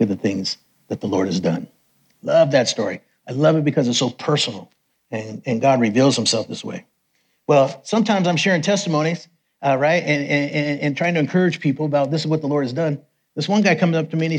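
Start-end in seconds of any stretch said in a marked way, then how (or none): none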